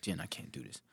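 breathy near-whisper, drained; whispered weariness. Retold or imitated. Retelling his reply to Jen, tired whisper. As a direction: whisper it like you're out of energy.

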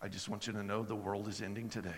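low, level, emotionless voice; a hint of a deadpan landlord; flat monotone impression. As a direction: say it with no emotion at all. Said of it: "he talks like this".

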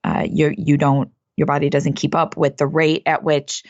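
normal talking voice

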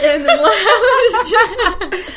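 laughing. Real, not acted. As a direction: laugh through the words. Laughing at her own story.

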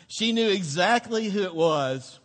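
usual speaking voice

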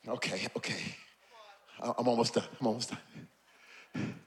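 very soft, low aside; hushed reassurance. Real, not acted. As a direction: drop right down, almost to nothing. Drops to placate the congregation.

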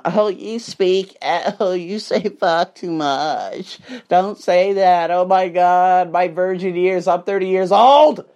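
slow, drawn-out mock complaint; imitating a scandalized whiner, slowly; mocking imitation. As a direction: drag the words out like a prissy complainer.

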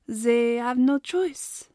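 slow, drawn-out, knowing; lightly French cook, cryptic and slow; slow mysterious French cook. A narrator's reading. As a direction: let it hang with mystery. Miss Mallory ominous.

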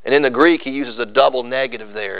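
usual speaking voice

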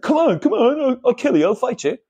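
high-pitched mock five-year-old fighter; tough little kid. Five-year-old threatens a grown man, mock tough. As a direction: imitate a small boy squaring up, pitched high. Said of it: "tiny little five-year-old trying to act tough".

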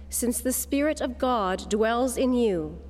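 ordinary talk

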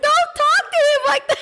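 very high, indignant squeal; mock outrage. Crying owner defending dog to partner, high mock-outraged squeal. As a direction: protest in a hammed-up, high-pitched wail.

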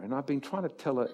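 ordinary talk

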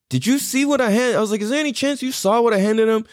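high, urgent plea to a stranger; begging the guy behind him in line to back him up, high and urgent; imploring bystander. Retold or imitated.

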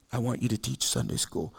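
voice of God. Retold or imitated. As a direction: speak it low and hushed like God in quiet time. God speaking quietly in quiet time.

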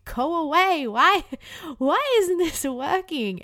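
loud, high-pitched exasperated plea; frustrated outburst. Acted. Crier scolding their own tears in the bathroom, loud frustrated outburst.

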